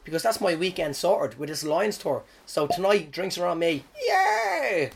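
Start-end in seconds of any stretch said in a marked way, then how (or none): 4.1-4.9 high, drawn-out little cheer; mock cheer. Comic cheering his own round of drinks, mock excited. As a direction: squeal a playful hooray.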